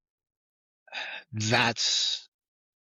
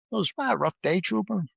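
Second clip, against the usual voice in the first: a much higher, amused doctor's voice; impersonating the doctor; 9 semitones higher than usual. Retold or imitated. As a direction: mimic the biker doctor's teasing remark. ER doctor sees tattooed arm.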